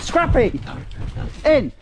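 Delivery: short high-pitched commands; ordering the dog in. Real, not acted. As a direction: call the dog inside firmly.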